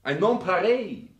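high, loud, grandiose praise; rapturous. Acted. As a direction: sell the Alps like a wonder of the world. Grandly praising the alpine glow.

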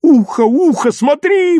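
very high, delighted squeals; squealing. A narrator's reading. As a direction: shriek with joy, rising to the top. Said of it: "взвизгивал Серёжа".

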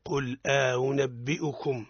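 slow قل أنبئكم with the hamza eased; model recitation. Shown as a demonstration. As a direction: recite قل أنبئكم slowly for the student to copy. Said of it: "أعدها". (تلاوة نموذجية بطيئة)

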